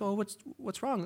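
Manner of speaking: soft, high, concerned spouse. Acted. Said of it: "Spouse walks in like".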